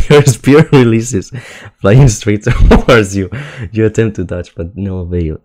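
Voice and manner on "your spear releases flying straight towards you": loud, slow, high words through laughter; laughing while reading. Real, not acted. Streamer reads the spear death to chat, laughing loudly. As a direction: read it aloud while cracking up.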